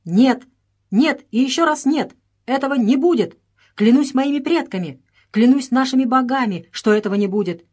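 high, loud furious refusal; furious vow. Acted. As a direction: swear it in a rage. Princess vows in fury.

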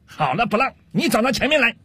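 high-pitched officer's orders; acted captain hollering at his subordinate; acted shouting. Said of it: "听到上尉大声嚷嚷".

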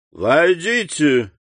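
a slow call of come in through the door. A narrator's reading. Beauchamp calls his visitor in from the office, slow call. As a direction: call the visitor in, a bit doubtful. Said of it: "крикнул".

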